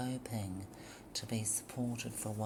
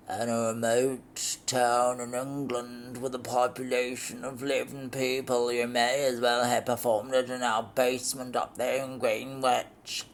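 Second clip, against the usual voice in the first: louder, lightly voiced man; sneering husband; 8 dB louder than usual. Husband mocking Lorraine's play in a tiny English town. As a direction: scoff at her little play.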